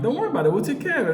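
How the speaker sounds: smooth it over, nothing to worry about; mock reassuring. To Cameron, covering for the lost bird: a nervous eager reassurance.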